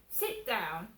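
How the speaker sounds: stern command. Retold or imitated. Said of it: "she went".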